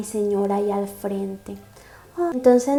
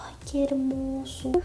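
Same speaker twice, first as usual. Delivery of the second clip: awed whisper; 11 dB quieter than usual. Real, not acted. Whispered swoon after line.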